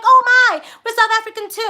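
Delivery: mock excited claim. Imitating a stranger falsely claiming to be South African, hammed-up excitement. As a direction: ham up a fake thrilled reaction. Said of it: "they'd be like".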